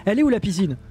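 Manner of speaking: lightly imitated Belgian accent; attempted Belgian accent. Streamer asking the viewer where the pool is, light Belgian accent. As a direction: ask it with a touch of Belgian. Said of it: "Quel bel accent belge".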